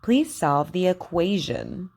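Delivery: careful teacher model sentences, KW sound stressed; over enunciating. Shown as a demonstration. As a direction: say each word clearly for learners to repeat. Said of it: "Repeat after me".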